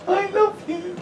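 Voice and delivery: high, soppy tipsy declaration; a lightly voiced drunk, gushing wife; drunk emotional wife. Wife drunk after a barbecue, high and soppy. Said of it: "a little bit emotional too".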